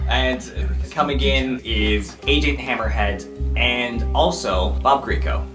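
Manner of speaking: normal talking voice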